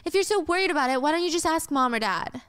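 high, quick irritated questioning; annoyed retort. Acted. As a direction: fire it back at a pestering sister. Brother snaps back at sister.

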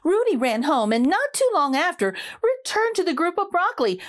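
plain reading voice

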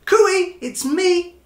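high, loud little-fish call. Acted. Stanley calling out to his friends, high cheerful call. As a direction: sing out to find your friends. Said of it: "he called to his friends".

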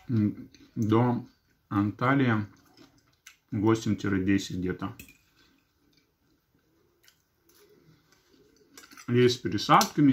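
talking between bites; chewing food while speaking; mouth full chewing. Real, not acted. Seen 4: Forkful of salad enters mouth at 3.2, then chews while speaking.

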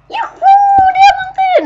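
very high, loud cheering and laughing; joyful yelling. Real, not acted. Gamer yelling over diamonds, ecstatic. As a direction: scream for joy at the diamonds. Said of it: "je to už trapný".

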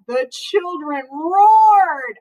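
ordinary delivery

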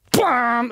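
high, loud bam like a full orchestra; imitated orchestra hit. Shown as a demonstration. Host mimicking the Star Wars opening blast, loud. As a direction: slam the opening chord. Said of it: "The Star Wars thing starts".

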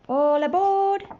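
high, loud conductor's cry; train's boarding call. A narrator's reading. Train calls the children to board, high loud shout. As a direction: sing it out to all the passengers. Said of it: "the train shouted".

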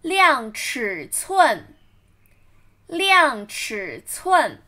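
量尺寸 spoken slowly and evenly; slow model reading. Shown as a demonstration. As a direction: read it slowly for learners. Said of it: "model reading".

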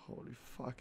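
very soft, low murmur; asombro en voz baja. Real, not acted. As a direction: breathe it out barely audibly. Susurra impresionado por el juego. (murmullo muy bajo de asombro)

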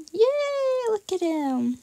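high, drawn-out squeal; long, cutesy high yay; little cheer. Real, not acted.

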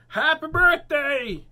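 long, high, loud holler; birthday shout. Acted. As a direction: belt out the birthday greeting. Turtle shouts greeting in story.

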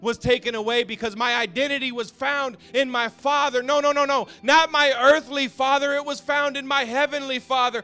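high, loud preaching, slowing on not my earthly father; full-force preaching. Acted. Preacher telling the congregation where his identity was found, full-force preaching. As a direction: proclaim it like the turning point of your life.

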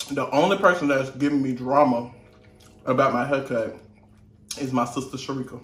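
usual speaking voice

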